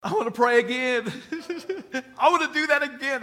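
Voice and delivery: high and loud, bursting with joy; overjoyed tearful plea. Retold or imitated. Young man after praying begs to pray again, overjoyed and loud. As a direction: beg to go again, thrilled and choked up. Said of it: "he's still just crying and he can't hardly talk".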